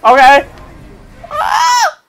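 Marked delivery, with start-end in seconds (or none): 1.3-2.0 sudden very high shout of alarm; startled shriek. Real, not acted. Gamer startled by his character falling, shrieking. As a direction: yelp as you fall.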